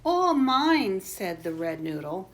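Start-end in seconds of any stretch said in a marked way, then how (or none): 0.1-1.0 loud, high storybook exclamation; greedy claim. A narrator's reading. Red noodle claims the whole pie, loud greedy cry. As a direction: stake the claim loudly. Boys quarrel over the pie.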